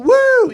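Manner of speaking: high, loud "woo"; cheering whoop. Real, not acted. Comedian rallying the crowd, high cheering whoop. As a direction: let out a big whoop.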